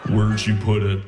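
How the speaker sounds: low, creepy, breathy-sounding Buffalo Bill imitation; mock serial-killer creep voice. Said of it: "Why do you sound like Buffalo Bill?".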